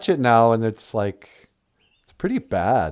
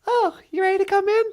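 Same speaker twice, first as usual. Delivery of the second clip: much higher playful lady voice; high female voice; faster than usual. Pretend. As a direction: play the sweet girlfriend calling in, high. Natasha voice continues.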